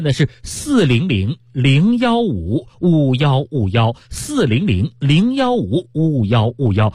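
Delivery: slow, deliberate hotline number; slow phone number read. Acted. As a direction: spell out each digit slowly. Reads order hotline number.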